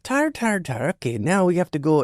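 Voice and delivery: mock Irish accent practice words; Irish accent warm-up. Actor recalling his warm-up trick, mock Irish accent. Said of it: "I had to do an Irish accent once".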